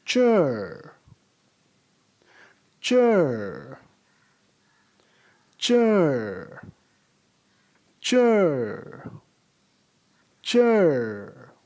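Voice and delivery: "Chur" one falling syllable, drawn out; repeat after me. Shown as a demonstration. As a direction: model "Chur" slowly for the class. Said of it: "pronounce it after me".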